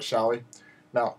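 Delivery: ordinary delivery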